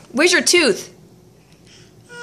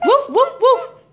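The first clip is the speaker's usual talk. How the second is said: high, playful woof woof barks; dog barking; 10 semitones higher than usual. Shown as a demonstration. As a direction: bark like a friendly puppy. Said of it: "What does a dog say?".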